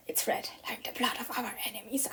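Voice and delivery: whispered vampire voice; drunk vampire. Pretend. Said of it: "I sound like a drunk vampire".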